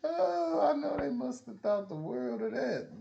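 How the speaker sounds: normal talking voice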